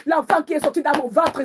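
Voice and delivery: normal talking voice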